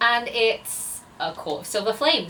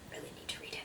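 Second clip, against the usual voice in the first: hushed whisper to herself; 21 dB quieter, much faster than usual. Real, not acted. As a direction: whisper it like a confession. Whispered aside about reading.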